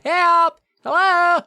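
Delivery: high, loud calls for help; calling for help. Retold or imitated. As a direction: shout up into the empty cave. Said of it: "Erin calls out for help".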